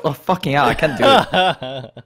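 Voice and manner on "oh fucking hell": ordinary delivery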